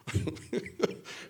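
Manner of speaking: short unvoiced laugh; quick amused laugh; breathy laugh. Real, not acted. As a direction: laugh at own impression. Laughs mid impression.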